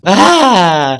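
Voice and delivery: loud, falling cry of frustration; exasperated yell. Acted. Son caught between father and grandfather, exasperated yell. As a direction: let out a fed-up scream.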